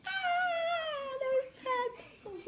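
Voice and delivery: put-on very high, slow shrieking child; mock scared scream. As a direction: squeal it like a terrified little girl.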